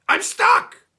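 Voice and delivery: very high, loud panicked shout; goblin shout. Acted. Trapped goblin shouting at Herschel, very high and loud. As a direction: yell out stuck in the jar. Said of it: "the goblin shouted".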